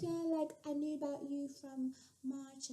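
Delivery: very soft, high little voice; barely audible, childlike murmur; imitated whispering girl. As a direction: mimic her tiny hushed phone voice. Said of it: "why are you whispering?".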